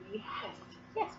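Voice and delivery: normal talking voice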